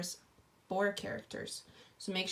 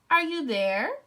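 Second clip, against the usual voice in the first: raised, high call to someone unseen; 5 semitones higher, 6 dB louder than usual. Acted. Girl calling for Grandma at the open door, raised voice. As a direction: call into the empty room. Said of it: "she called".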